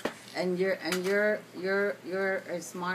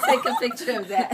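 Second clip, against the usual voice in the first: laughing; 9 dB louder than usual. Real, not acted. Laughing at child.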